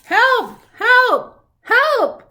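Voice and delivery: very high, loud repeated shouts; hippo crying help. Acted. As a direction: yell for help like a frightened hippo. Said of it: "cried the hippo".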